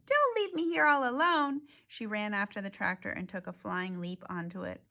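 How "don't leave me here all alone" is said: high, loud pleading; panicked plea. Acted. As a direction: beg them not to leave. Sheep pleading after the tractor.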